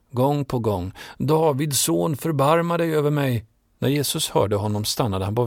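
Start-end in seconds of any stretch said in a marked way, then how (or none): 1.2-3.4 calling even louder. A narrator's reading. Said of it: "Men han ropade bara högre". (ropar ännu högre, långsamt och högt)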